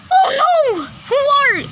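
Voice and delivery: high, loud shocked cry; mock startled outcry; alarmed shout. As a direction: yelp it in sudden fright. Shock at the stranger's return.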